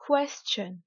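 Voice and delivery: teacher's over-stressed "question", falling after KWES; exaggerated English stress. Shown as a demonstration. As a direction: punch KWES in "question", light "chun". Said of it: "je vais délibérément exagérer".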